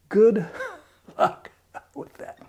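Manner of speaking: good luck whispered through a laugh. Real, not acted. Vlogger mocking Trump voters, whispered laughing jab. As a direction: breathe it out, chuckling, no voice.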